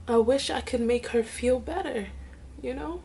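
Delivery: barely holding it together; on the verge of tears. Real, not acted. Wishing she could help her sick mother, tearful. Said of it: "let me pull myself together".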